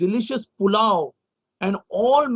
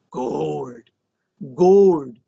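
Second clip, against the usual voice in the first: usual speaking voice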